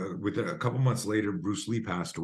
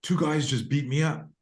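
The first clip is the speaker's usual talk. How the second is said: high, loud and eager; excited kid telling dad; 7 semitones higher, 5 dB louder, faster than usual. Retold or imitated. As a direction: blurt out the news to dad. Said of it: "I was like".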